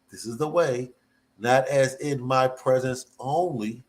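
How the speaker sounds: usual speaking voice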